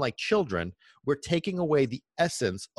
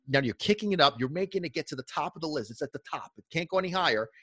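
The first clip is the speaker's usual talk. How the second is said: ordinary talk, nothing marked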